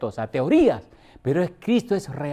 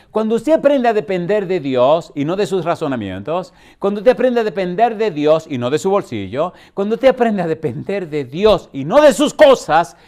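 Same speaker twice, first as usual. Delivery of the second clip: louder with each repeat, preaching at full force; building sermon refrain; 2 semitones higher, 7 dB louder than usual. Real, not acted. Pastor exhorting the congregation to depend on God, rising in force.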